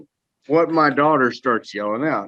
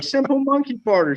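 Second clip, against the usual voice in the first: put-on much higher, squeaky toddler parroting; imitating a toddler; 13 semitones higher than usual. Dad mimics his clapping toddler, high and gleeful. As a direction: chirp it like a delighted little kid. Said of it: "She's like".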